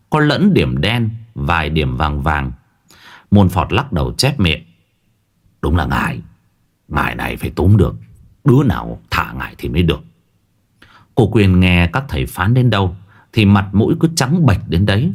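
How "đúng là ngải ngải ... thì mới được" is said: lower, quick grim verdict; low grim shaman. A narrator's reading. Môn Phọt judges the curse, low and quick. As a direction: mutter it down like a weary shaman.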